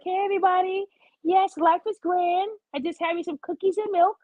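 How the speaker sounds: high, slowed-down, overly cheerful hostess voice; sickly-sweet mock greeting, pitched high; mock sweet sugary voice. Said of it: "I'm sorry that my voice is not like this".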